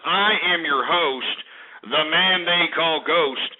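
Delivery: slow, drawn-out radio introduction; announcer intro. Real, not acted. Host presenting himself to listeners, slow announcer delivery. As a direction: stretch out each word like an announcer. Host's signature show introduction.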